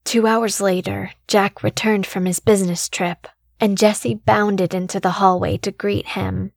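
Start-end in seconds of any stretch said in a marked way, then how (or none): none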